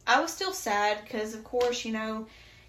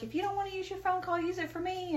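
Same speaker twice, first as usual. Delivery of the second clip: high, quick imitation of a pleading woman; imitated cellmate angling for a favor; fellow inmate's request; 10 semitones higher, faster than usual. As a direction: put on a higher voice, rushing the request. Voices another woman in jail.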